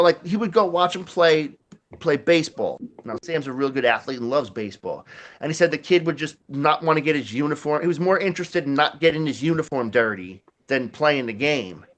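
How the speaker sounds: normal talking voice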